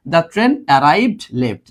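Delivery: very slow, each word drawn out; teacher repeating the example slowly; slow model read. Shown as a demonstration. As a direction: repeat it slowly pointing at late. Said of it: "দেখো কোথায় বসছে?".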